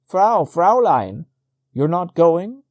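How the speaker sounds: loud, high, slow drawn-out call; shouting after her. A narrator's reading. Peduzzi shouting after the wife climbing away, loud and slow. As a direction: call her back across the bank, loud. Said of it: "he shouted".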